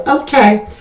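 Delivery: sassy high-voiced okay; Candy. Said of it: "in my candy voice".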